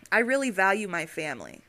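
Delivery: ordinary talk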